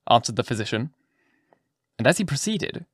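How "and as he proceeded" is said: plain reading voice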